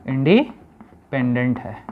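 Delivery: Independent split by a pause midway; slow, broken-up repeat. Shown as a demonstration. Teacher repeating the term for pupils, slow and split. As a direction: break independent in two, slowly.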